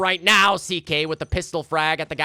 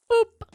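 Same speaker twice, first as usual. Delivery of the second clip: boop; 4 dB louder than usual. Pretend. Sound effect on replay.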